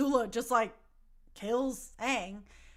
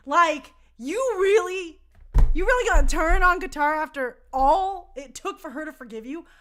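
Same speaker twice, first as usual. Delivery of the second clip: loud, higher, indignant scolding; outraged scolding; 5 semitones higher, 11 dB louder than usual. Real, not acted. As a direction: tell him off like a betrayed friend.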